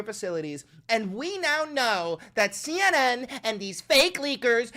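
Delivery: slow, pompous official voice; mock press secretary. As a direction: ham up a press secretary's praise. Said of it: "No Lip Levitt is gonna come out and go".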